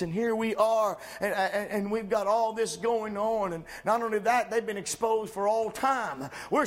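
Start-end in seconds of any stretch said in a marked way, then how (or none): none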